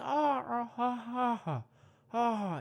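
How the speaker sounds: high ah ha monkey hoots; monkey grievances. Pretend. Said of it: "just talk to".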